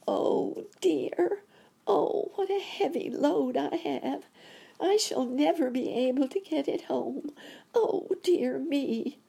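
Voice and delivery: very high, tiny creature voice; little voice crying out. A narrator's reading. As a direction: wail plaintively in a tiny high squeak. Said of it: "a little voice crying".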